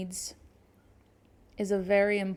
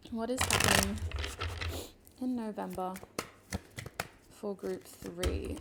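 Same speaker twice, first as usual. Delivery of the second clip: invocation to spirit. Acted. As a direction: ask the spirit gently and reverently. Asks spirit again for cards.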